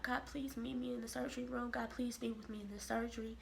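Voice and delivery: soft, hushed plea to God; quiet retold prayer. Woman praying to God before emergency surgery, hushed. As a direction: murmur it like a private prayer.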